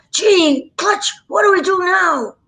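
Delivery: put-on high, loud little-boy voice. Shown as a demonstration. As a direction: pipe up as an excited young lad. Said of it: "I did the little boy on".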